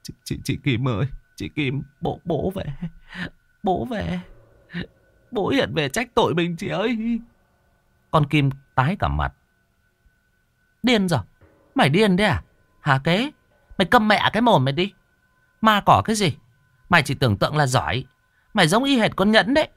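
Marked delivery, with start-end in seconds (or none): 0.0-7.2 softer, stammering whimper rising higher; softer frightened whimper, mildly voiced. A narrator's reading. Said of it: "Thằng Kế mếu máo". 10.8-19.7 much higher, fast, sharp woman's scold; high fast angry woman. Acted. Woman scolding frightened brother.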